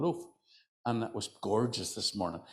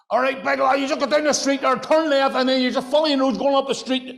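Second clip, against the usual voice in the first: much higher, much louder gruff Belfast bark; Belfast driver shouting; 10 dB louder than usual. Retold or imitated. A Belfast driver giving a tourist directions, shouting. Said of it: "And they're shouting at you".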